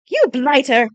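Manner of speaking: loud, indignant outburst; angry knight. A narrator's reading. Galloway furious at the man holding his horse, angry outburst. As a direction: spit the insult at him.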